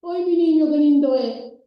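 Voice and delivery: put-on loud, high gushing over a baby; over-the-top doting exclamation; gushing delight. Shown as a demonstration. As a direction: coo loudly as if admiring a child. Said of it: "hablo de palabra y hablo de voz".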